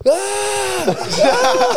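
very high drawn-out scream; mock wild yell. Mate imitating a bloke yelling behind on a scooter, wild high yell. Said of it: "he was just yelling".

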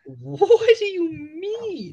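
high, slow fake-innocent voice; mock innocent. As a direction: play dumb in a high voice. Teasing friend feigns innocence.

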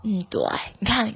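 ordinary talk, nothing marked

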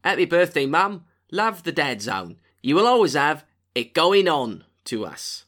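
loud attempt at Australian via Cockney; Cockney-style Australian accent. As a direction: do Cockney and hope it passes for Australian. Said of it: "I'll try and do Cockney".

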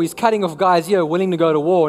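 ordinary talk

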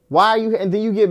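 exasperated yell. Real, not acted. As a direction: nearly shout the repeated question.

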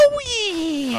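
a sudden high shrieking cry of the name; fangirl shriek. Retold or imitated. Mocking a fan spotting [name], high fangirl scream. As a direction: squeal it like a starstruck fan. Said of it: "He, like, fangirls out".

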